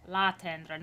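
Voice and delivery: L'atteindre with an open A sound; open A vowel. Shown as a demonstration. Coach correcting the vowel for the singer. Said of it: "not l'éteindre".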